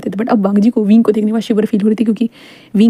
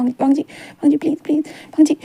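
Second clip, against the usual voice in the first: 1 breathy moaning plea; 5 semitones higher, 4 dB quieter than usual. Acted. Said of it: "मौन करने लगता है".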